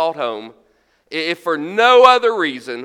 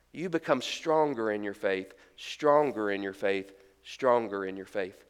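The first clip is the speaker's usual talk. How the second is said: quiet, lower, building repetition; soft repeated emphasis; 9 semitones lower, 10 dB quieter than usual. Acted. Preacher to congregation on suffering, softly insistent. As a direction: let each repeat sink in softly. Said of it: "repeated for emphasis".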